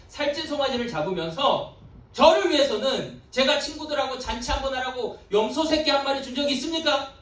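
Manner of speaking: high, loud protest of an aggrieved son; angry complaint. Acted. Elder son protesting to his father about the feast, angry. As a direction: pour out the grievance at full voice. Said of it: "이렇게 아버지한테 막 화를 내며".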